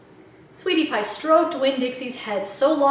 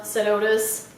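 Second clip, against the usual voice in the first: ordinary delivery, nothing marked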